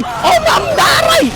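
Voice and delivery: high, squealed cry of pain; alarmed yelp. Real, not acted. Player whose character gets hit, yelping in alarm. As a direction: yelp as if struck.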